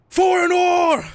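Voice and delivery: piercing high battle cry; scream. Acted. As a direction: hurl it out as a furious scream. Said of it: "he just screams".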